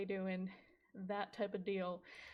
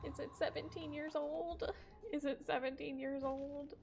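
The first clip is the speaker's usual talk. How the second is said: higher, laughing disbelief; mock shock; 5 semitones higher, 5 dB quieter than usual. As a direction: gasp it out like you just found out, half laughing. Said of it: "And I was like".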